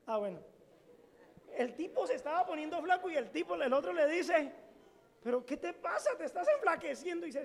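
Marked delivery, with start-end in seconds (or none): 5.2-7.3 pregunta alta y fuerte del primo; voz animada del primo; primo preocupado. Retold or imitated. (el primo le pregunta a Amón, más alto)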